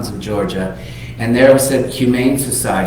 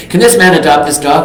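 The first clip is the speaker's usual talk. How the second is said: louder and higher, pushing the question; eager loud request; 6 semitones higher, 8 dB louder than usual. Retold or imitated. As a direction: press the worker like it's already decided. Tom eagerly asks the pound worker.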